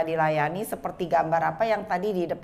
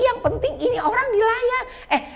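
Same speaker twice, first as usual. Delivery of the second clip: high, loud, stumbling self-parody; bumbling prayer; 5 dB louder than usual. Retold or imitated. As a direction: trip over the words in a high, urgent voice. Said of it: "saya ngomongnya belepotan".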